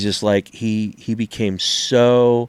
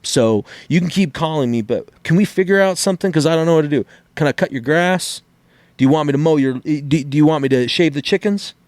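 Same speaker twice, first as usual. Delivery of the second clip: higher, half-joking begging; mock pleading debtor; 5 semitones higher than usual. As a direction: play a broke guy pleading with the bill collector, hammed up. Acting out a plea to bill collector.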